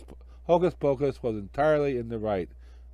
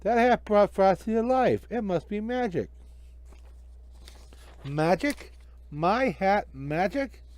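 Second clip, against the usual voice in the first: put-on higher kid voices, insistent; children arguing, much higher; 8 semitones higher than usual. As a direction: kids standing up for Frosty. Children argue with magician.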